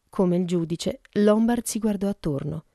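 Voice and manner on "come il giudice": plain reading voice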